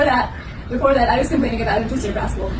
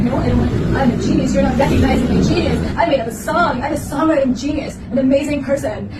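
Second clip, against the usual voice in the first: higher, indignant self-proclaimed genius; mock boasting; 3 semitones higher, 4 dB louder than usual. Classmate refusing to shut up, mock indignant boasting. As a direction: protest like a conceited kid.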